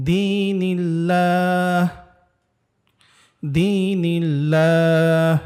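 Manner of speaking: বিসমিল্লাহ, দীনিল্লাহ chanted slowly with a thin, light লাম; thin lam. Shown as a demonstration. Teacher demonstrates the thin লাম for students, slow chant. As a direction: stretch the words slowly and keep the লাম thin. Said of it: "লামটাকে আমরা চিকন করে পড়ছি".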